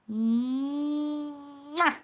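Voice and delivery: long hummed kiss with a smack; blown kiss. Real, not acted. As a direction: blow a big drawn-out kiss. Blows a kiss to viewers.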